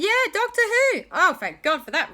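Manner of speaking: high, loud burst of relief; acted relief, pitched well up; excited relief. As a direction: exclaim it like a rescue is coming. Relieved confirmation in story.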